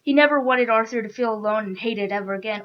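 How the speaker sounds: plain reading voice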